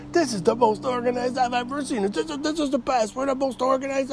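high mock-proud voice; mocking Dave's boast. Mocking how his buddy bragged about the mess, high and smug.

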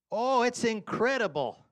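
loud, high gushing praise; overselling the chili. Retold or imitated. Dad selling chili to the boys, loud gushing. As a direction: hype the chili to sell it. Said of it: "losing credibility".

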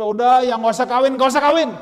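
loud, blunt mock Paul; mock loud advice. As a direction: hammer the advice home. Paul's advice voiced mockingly. (nasihat Paulus yang keras dan blak-blakan)

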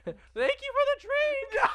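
nervous squeak, pitch shooting up; voice way up high. Retold or imitated. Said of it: "My voice just went up three octaves".

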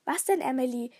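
usual speaking voice

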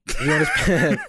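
high squeaky laughing echo; mock his line while cracking up. Real, not acted.

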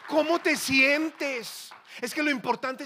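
loud, high, drawn-out question; mocking shout. Preacher attacking talk of feelings, loud and scornful. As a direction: belt out the question slowly, deriding it.

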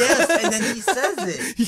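high, loud and drawn out through laughter; delighted laughing shout. Real, not acted. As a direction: cry it out while cracking up. Cracking up over the cartoon joke.

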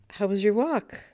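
bright, overly casual greeting; falsely cheerful. Retold or imitated. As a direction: act breezy, hide the nerves. Wife greets husband, hiding fear.

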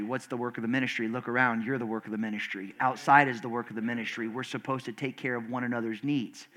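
ordinary talk

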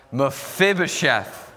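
Mephibosheth said a bit slowly with the crowd, stress on "phib"; counting the church in, then Mephibosheth together; saying it together. Shown as a demonstration. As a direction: lead the room through Mephibosheth in unison, a touch slow. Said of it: "Let's try and say this together".

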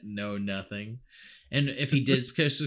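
ordinary talk

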